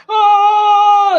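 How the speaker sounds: high loud slow-motion yell; Austin Powers yell. Pretend. Imitating Austin Powers for viewers with a high slow-motion yell. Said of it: "Austin Powers driving at, like, one mile an hour".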